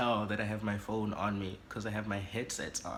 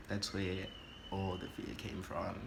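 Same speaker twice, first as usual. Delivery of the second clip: usual speaking voice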